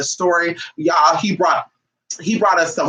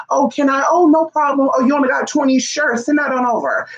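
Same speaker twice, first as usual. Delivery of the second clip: mock pushover voice; 8 semitones higher than usual. Mocking her old pushover self.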